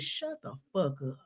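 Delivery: slow, low and quiet, dead serious; retold mad retort. Host quoting the furious woman's reply, quiet retold anger. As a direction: imitate her cursing someone off, low and unsmiling. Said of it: "She's so mad".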